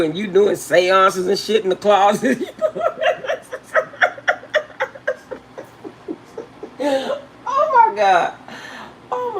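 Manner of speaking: loud laughing, voice cracking up high. Real, not acted. Joking to viewers about partners doing seances, laughing hard. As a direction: laugh so hard the words break. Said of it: "I'm laughing".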